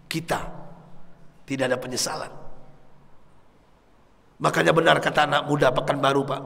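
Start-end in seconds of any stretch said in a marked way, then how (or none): none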